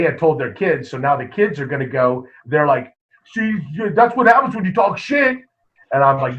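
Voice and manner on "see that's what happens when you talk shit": cocky taunt. Retold or imitated. Kids taunting after the win.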